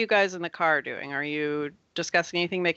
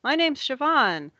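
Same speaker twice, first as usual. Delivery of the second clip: put-on high, chirpy little-kid voice; eight-year-old child; 10 semitones higher, 4 dB louder than usual. Siobhan greets the new kid, eager child voice. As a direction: introduce yourself like an eager child, pitched high. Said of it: "Siobhan's like".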